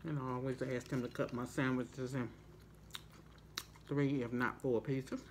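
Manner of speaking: speaking between bites; talking while chewing. Real, not acted. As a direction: mouth partly full of food. Seen 1: Bit sandwich at -3; lips working, chip at mouth while talking.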